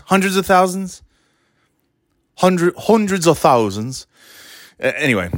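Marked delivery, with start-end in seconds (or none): 0.1-4.1 lightly put-on British accent, pitched higher. Trying out what UK friends call sprinkles, mock British accent. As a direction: try a British accent, questioning. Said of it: "my friends from the U K".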